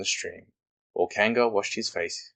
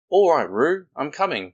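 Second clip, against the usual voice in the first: higher, louder call across the water; 8 semitones higher, 7 dB louder than usual. Acted. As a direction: call out reassurance to Roo. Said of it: "called Christopher Robin".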